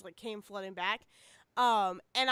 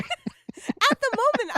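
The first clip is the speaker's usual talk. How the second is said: loud; 11 semitones higher, 9 dB louder, slower than usual. Real, not acted. Sudden high loud voice.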